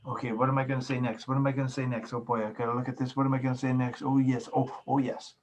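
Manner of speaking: racing, anxious self-talk under the breath; hurried inner monologue. Acted. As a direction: rattle through panicky thoughts quickly. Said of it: "You're just in your mind saying".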